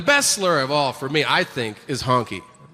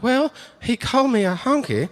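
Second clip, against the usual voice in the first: hillbilly voice; 8 semitones higher than usual. Retold or imitated.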